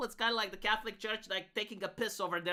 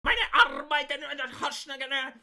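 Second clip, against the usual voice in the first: mock German speech, shouted and higher; Hitler; 4 semitones higher, 7 dB louder than usual. Streamer parodying the clip's cadence as Hitler, mock shouting. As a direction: rant in fake German like a dictator at a rally. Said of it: "look at Hitler".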